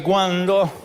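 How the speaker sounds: "¿Cuándo?" repetido con impaciencia; impaciencia insistente. Retold or imitated. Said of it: "miran al reloj y dicen". (un "¿Cuándo?" más insistente)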